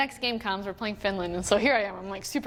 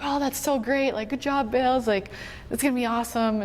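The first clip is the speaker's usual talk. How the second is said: imitated peppy teammates, pitched higher; gushing congratulations; 3 semitones higher than usual. Teammates congratulating her on being fourteenth forward, gushing imitation. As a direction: play the over-encouraging teammates, higher and lively.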